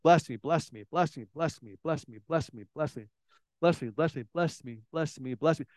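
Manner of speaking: soft mock rote prayer; mindless repetition. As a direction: drone it on repeat with no heart behind it. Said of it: "a lot of times it's just mindless".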